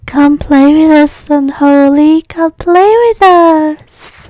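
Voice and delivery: put-on slow, eerie, high ghost child; creepy child voice. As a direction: drag it out like a haunted kid. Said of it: "special request in the chat".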